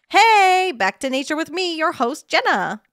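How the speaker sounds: bright loud high host opener. Acted. Streamer voicing nature show host, high and loud. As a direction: greet viewers like a cheerful nature host.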